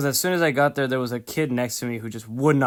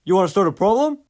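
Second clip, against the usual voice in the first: louder, higher tough-guy confrontation; aggressive challenge; 5 semitones higher, 5 dB louder, faster than usual. Retold or imitated. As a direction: square up to the stranger you bumped. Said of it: "respond with".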